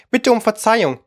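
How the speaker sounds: frowning but polite. A narrator's reading. Mouse checking on the yawning parrot, frowning yet polite. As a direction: sound offended but keep it courteous. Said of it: "mit gerunzelter Stimme, aber sehr höflich".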